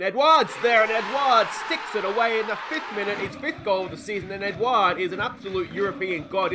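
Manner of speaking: loud, high, thrilled commentary; excited goal call. Real, not acted. Manager calling his striker's goal to viewers, excited shout. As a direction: call the goal like a fan going wild.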